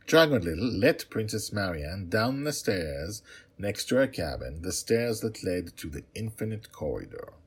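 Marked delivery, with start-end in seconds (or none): none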